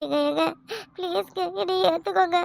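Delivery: sobbing, soft begging; crying plea. Acted. Wife weeps and begs her husband to take her back. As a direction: beg through tears.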